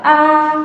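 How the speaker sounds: "Ah" held high and level; first tone. Shown as a demonstration. Teacher showing learners the tone, "Ah" high and level. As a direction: sustain "Ah" on one high note. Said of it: "yang penting dia datar".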